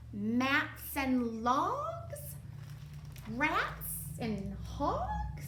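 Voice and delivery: slow puzzled musing; musing rhymes. Acted. Delia pondering rhymes to herself. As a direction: try the rhymes out slowly. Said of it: "she mumbled to herself".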